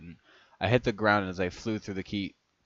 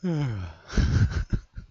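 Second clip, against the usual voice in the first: long sigh of exhaustion from laughing; breathless sigh after laughing; 4 semitones higher, 4 dB louder than usual. Real, not acted. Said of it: "I have to calm down".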